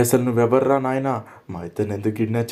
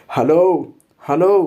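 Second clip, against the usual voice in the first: loud hopeful calls of hello into emptiness; calling out; 6 semitones higher, 5 dB louder, much slower than usual. Acted. Lost man calling out for anyone nearby, loud call. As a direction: shout hello to find anyone. Alone in snowy restricted place.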